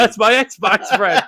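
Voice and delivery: slow, high, laughing quote; mock the line while cracking up; laughing mockery.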